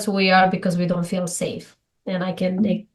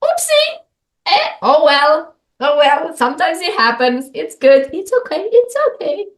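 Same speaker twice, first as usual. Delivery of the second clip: much higher, sing-song shrug-it-off voice; breezy oopsie; 8 semitones higher than usual. Retold or imitated. Woman showing her new kind reaction, high and sing-song. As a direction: lightly laugh off the mistake. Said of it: "and now I'm like".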